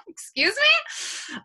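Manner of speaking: very high, loud, incredulous retort; mock indignation. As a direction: replay your offended disbelief, slow and high. Reaction to rude accent comment.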